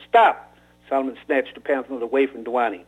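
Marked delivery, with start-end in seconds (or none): 0.1-0.5 sharp shout. Acted. Gunman cutting off reading.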